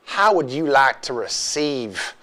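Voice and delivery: slow, hammed-up Southern accent; ridiculous Southern accent. Co-host attempting a California line on request, in a botched Southern accent. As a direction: stretch it out in a silly Southern accent. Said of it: "you did some ridiculous Southern accent".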